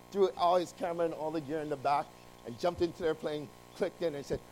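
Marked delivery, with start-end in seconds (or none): none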